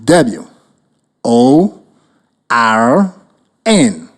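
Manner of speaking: letters "W", "O", "R", "N" spaced apart; spelling it out. Shown as a demonstration. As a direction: dictate "W O R N" letter by letter. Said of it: "write the word spelled".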